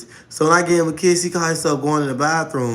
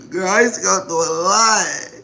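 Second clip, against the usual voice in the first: usual speaking voice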